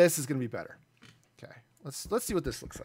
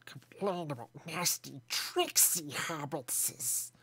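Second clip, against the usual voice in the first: slow, soft creepy creature voice drawing out hobbitses; 5 dB quieter, slower than usual. Shown as a demonstration. Streamer demos a creepy voice for chat, slow and soft. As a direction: hiss it slowly like a cave creature. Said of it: "or I could be creepy".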